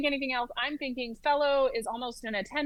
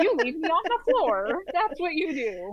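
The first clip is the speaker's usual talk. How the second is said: breezy sassy reply; 5 semitones higher, 5 dB louder than usual. Retold or imitated. Telling nurse to leave her.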